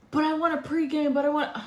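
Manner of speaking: high, wheedling protest, imitating him; mock whining. Date begging woman to pregame, mock whining. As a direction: whine it like a petulant date.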